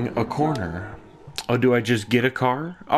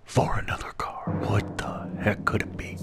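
whisper; 5 semitones lower, 9 dB quieter than usual. Real, not acted. Hushed anticipation before reveal.

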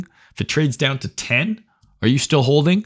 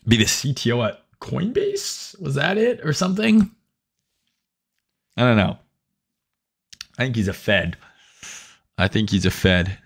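usual speaking voice

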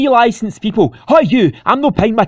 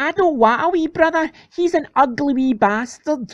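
put-on sulky child; 4 semitones higher than usual. Child voiced, rejects baby brother.

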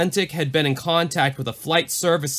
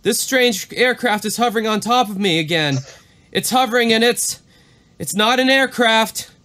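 alarmed pilot; 7 semitones higher than usual. Retold or imitated. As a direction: say it like it dawns on you in fear. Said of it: "Back to the exchange".

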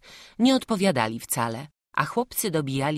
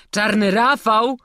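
loud, high incredulous question, drawn out; scornful disbelief; 7 semitones higher, 8 dB louder than usual. A narrator's reading. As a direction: echo the name in mocking disbelief, rising. Guard scoffs at the boys' claim. (drwiące niedowierzanie)

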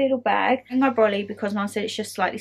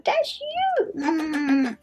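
much higher, doting squeak to the dog; 6 semitones higher, much slower than usual. Real, not acted. Cooing at the dog.

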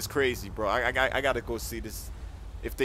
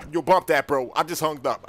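ordinary talk, nothing marked

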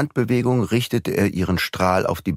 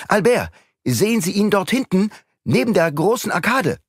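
calling out; 12 semitones higher than usual. Acted. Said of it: "Raymond rief den anderen Diener".

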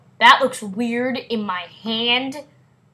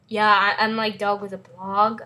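ordinary talk

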